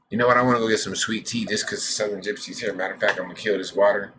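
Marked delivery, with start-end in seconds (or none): none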